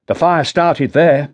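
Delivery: younger detective, steady and measured; Rutter's voice. Acted. Sergeant explaining the scene to his boss, measured.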